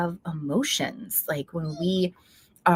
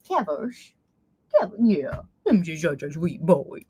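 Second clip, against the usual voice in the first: cooing to the dog. Real, not acted.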